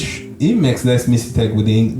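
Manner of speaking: normal talking voice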